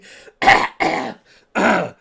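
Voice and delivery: loud choking cough; coughing fit. Real, not acted. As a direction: cough hard mid-sentence. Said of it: "I'm choking on my words".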